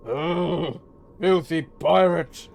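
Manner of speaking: groan, then spit the insult; disgusted insult. Acted. Wounded mage looking down at the pirate, disgusted.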